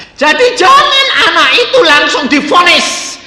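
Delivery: loud, high preacher's warning; forceful preaching. Acted. Preacher warning parents loudly. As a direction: drive the point home to the congregation.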